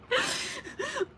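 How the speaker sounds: squeaky giggle; high-pitched laugh; high squeal of laughter. Real, not acted. High laugh after farting.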